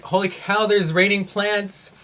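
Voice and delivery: high, loud mock alarm; acted astonishment. Retelling plants falling from above, acted astonishment. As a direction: exclaim it in startled disbelief, stretching the end.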